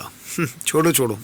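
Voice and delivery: ordinary delivery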